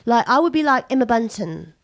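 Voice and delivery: chatting in a put-on Essex accent.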